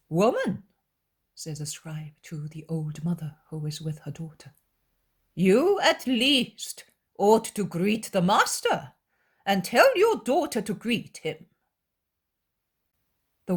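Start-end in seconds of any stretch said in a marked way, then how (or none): 0.1-0.7 calling out. Acted. Scribe calls the old mother. 5.3-11.5 louder, higher, slow insistence; pressing. Acted. Scribe pressing the mother to make her daughter greet, insistent.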